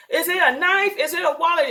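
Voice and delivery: high, alarmed mock shouting; half-acted crowd panic; retold panicked yells. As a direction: toss out frantic guesses from the crowd. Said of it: "somebody yelled".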